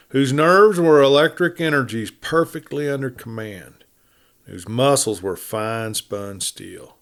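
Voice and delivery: usual speaking voice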